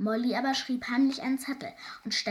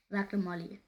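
ordinary delivery, nothing marked

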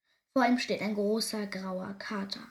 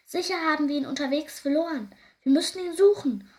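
high, loud, hurried mother; urgent calling out; 6 semitones higher, 8 dB louder than usual. A narrator's reading. Hare mother urging a search, urgent loud call. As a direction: raise the alarm, building to a cry. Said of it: "ruft die Hasenmutter".

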